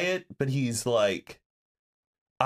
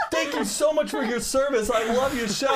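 higher, laughing gush; gushing fan; 11 semitones higher than usual. Retold or imitated. Fan gushing to the cart guy. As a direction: thank him like a starstruck fan. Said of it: "And I'm like".

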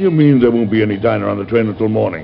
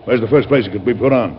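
usual speaking voice

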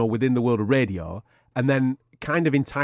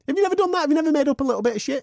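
incredulous question; 13 semitones higher, much faster than usual. Real, not acted. Challenging his co-host.

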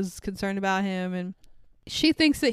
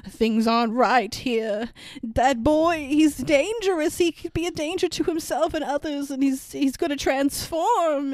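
a hint of a scared local, pitched up and slowed; ominous warning; 5 semitones higher than usual. Bar man warning the doctor, lightly voiced ominous plea. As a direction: warn him gravely, half-acted.

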